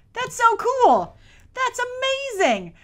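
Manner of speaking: drawn-out, high, loud awe; awed exclamation. Real, not acted. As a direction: really marvel at it, slowly. Gasping at droid's swagger.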